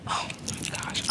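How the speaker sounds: slow whispered oh my gosh; stressed whisper. Real, not acted. Stressed after missing eagle.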